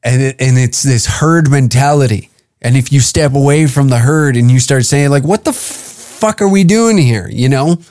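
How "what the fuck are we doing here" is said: exasperated question. Retold or imitated. Acted quote of a questioner.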